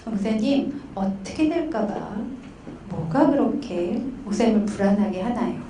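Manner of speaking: soft, unhurried probing; gentle counseling question. Retold or imitated. Counselor asking pastor about his anxiety, soft and careful. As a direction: ask tenderly, giving room to answer.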